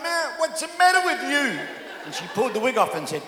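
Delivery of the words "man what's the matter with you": high, louder startled cry; startled outburst. Retold or imitated. Pastor recalling a stranger's kiss at the airport, startled outburst. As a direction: blurt it out, caught off guard by a stranger's kiss.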